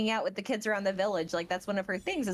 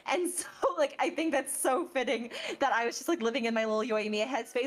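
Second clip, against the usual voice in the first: ordinary delivery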